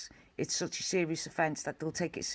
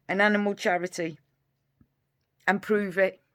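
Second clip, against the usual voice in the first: angry; 3 semitones higher, 7 dB louder than usual. Real, not acted. Furious challenge to YouTubers.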